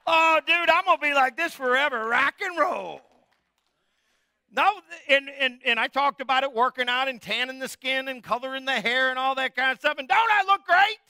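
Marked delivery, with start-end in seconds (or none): 0.1-3.0 put-on higher, louder, cocky young voice; cocky young dude. Preacher mocks young people who think they stay young, loud and cocky. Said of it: "You're going". 10.1-11.0 much higher and louder vain preening; vain boast. Retold or imitated. Preacher mocks people fussing over looks, high vain boast. Mocking vain self-improvement.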